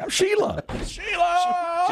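hammed-up high shout; shouted name. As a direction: yell the name at him. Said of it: "she's like".

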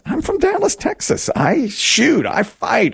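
high-pitched, gung-ho newcomer in a joke; eager boasting. Acted. Newcomer assuring his neighbor he can fight, eager high-pitched boasting. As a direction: act out keen bravado, voice jumping up high.

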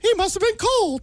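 cartoon mouse commentary; Mickey Mouse. Guest adds a quip after the headline, cartoon mouse voice. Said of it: "Mickey Mouse? Where'd that happen?".

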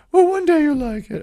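high-pitched imitation of his mother; mother's voice. Mother coaxing her teenage son, high imitated voice. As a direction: pitch way up and coax like Mom. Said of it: "She talked like that".

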